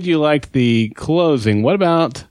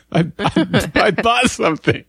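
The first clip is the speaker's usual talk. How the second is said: giggly confession, pitched up; laughing; 9 semitones higher, slower than usual. Real, not acted. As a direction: laugh through the admission.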